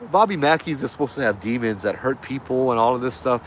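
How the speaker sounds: ordinary delivery, nothing marked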